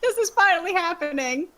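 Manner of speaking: high, squeaky happy-crying imitation; hammed-up sob of overjoyed fan; mock hysterical sobbing. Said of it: "Sobbing hysterically".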